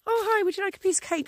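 put-on toddler voice. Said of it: "you need to be like".

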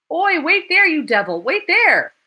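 loud, high chasing yell; man shouting after a runaway on the stairs. A narrator's reading. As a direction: bellow after someone fleeing. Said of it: "With a cry".

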